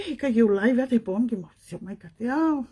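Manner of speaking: usual speaking voice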